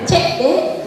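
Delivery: usual speaking voice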